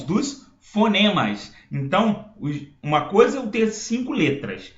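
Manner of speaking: ordinary talk, nothing marked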